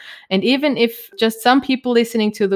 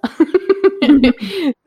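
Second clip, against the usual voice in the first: a burst of high laughter; bright laugh; 8 semitones higher, 6 dB louder than usual. Real, not acted. Laughing along in podcast chat.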